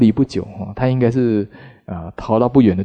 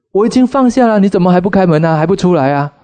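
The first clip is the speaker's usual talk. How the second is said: much higher and louder impatient yelling; angry demand; 8 semitones higher, 5 dB louder than usual. Retold or imitated. Angry husband yells at the Buddha to open the gate, loud and impatient. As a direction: bang on the gate and demand answers.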